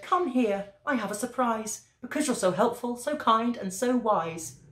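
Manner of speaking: a hint of a fond, high-voiced wife; kindly wife. A narrator's reading. Wife's dialogue in a read story.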